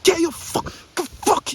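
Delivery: shouting. Shown as a demonstration. Vlogger demonstrating shouting at viewers, high yell. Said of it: "if I start shouting at you".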